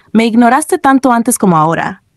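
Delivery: plain reading voice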